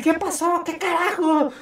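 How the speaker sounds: high startled yelp; shocked. Retold or imitated. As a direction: jump up high in alarm. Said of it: "Y decir".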